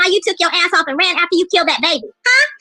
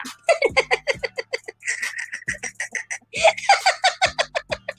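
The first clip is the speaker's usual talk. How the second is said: drawn-out high-pitched laugh; high, soft run of laughter; long laugh; 9 semitones higher, 7 dB quieter than usual. Real, not acted. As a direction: laugh softly in a long rapid string. Laughing at her own jab.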